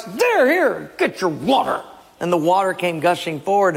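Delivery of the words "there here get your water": angry Moses. Acted. Moses striking the rock.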